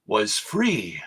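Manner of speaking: ordinary talk, nothing marked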